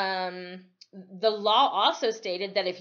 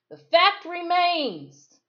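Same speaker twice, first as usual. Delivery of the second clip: high, loud, slow insistence; breathless; 10 semitones higher, 8 dB louder, slower than usual. Acted. Deputy insisting to the roaring crowd, high and loud. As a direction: push it out short of breath. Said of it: "he said breathlessly".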